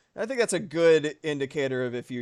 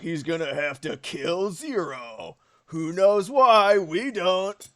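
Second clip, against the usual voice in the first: hammed-up teaser voice swelling louder and higher; mock dramatic; 4 semitones higher, 5 dB louder, slower than usual. Streamer mocks the game's nonsense plot, mock dramatic. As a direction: draw out the silly mystery in mockery.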